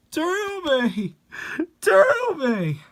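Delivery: high, drawn-out call of her name. Acted. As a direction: wail her name to the sky. Fans calling her name.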